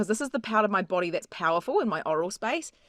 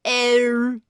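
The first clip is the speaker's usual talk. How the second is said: bright ringing ow; 2 semitones higher than usual. Shown as a demonstration. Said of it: "think of the vowel eero".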